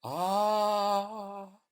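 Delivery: high sung moan; soft eerie moan. Acted. Ancient seas singing, soft high moan. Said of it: "sang the ancient seas".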